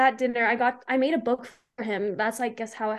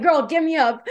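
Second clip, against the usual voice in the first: loud, raised-pitch demand to a friend; urgent pleading; 4 semitones higher, 5 dB louder than usual. Retold or imitated. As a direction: beg her insistently, half laughing. Begs friend to get her up.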